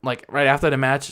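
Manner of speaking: normal talking voice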